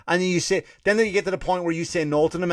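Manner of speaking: ordinary talk, nothing marked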